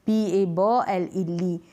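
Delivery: बी ए ब एल ई ली dragged letter by letter; demonstrating the mistake slowly; wrong spelled-out reading. Shown as a demonstration. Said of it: "आप ऐसे नहीं पढ़ेंगे".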